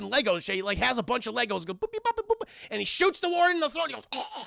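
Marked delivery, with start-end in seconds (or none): 1.7-2.5 high, cartoonish boops; imitated assembly beeps. Imitating a gun built like Legos, playful beeps. 4.1-4.4 soft choked gag; mock choking on a shot throat; imitated gagging. The warden shot in the throat, mock gagging. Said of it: "he starts fucking gagging".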